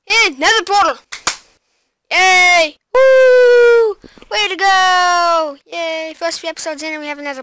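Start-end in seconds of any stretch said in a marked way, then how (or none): 0.1-6.1 loud high cheer; excited cheering. Real, not acted. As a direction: whoop with excitement. Cheering at new Nether portal.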